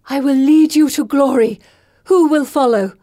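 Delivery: rally followers to glory; commanding proclamation. Acted. Pippa summons followers grandly.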